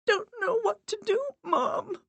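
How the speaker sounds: high, tearful pleading; voice breaking. A narrator's reading. Miranda begs her dead mother, tearful and high. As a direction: plead while crying. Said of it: "Miranda's voice broke".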